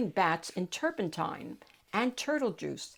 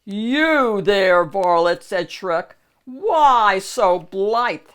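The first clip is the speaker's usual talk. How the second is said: loud, haughty ogre hail; loud lordly ogre shout; calling out loudly; 9 dB louder, slower than usual. Acted. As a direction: bellow at the peasant imperiously. Ogre calls to peasant.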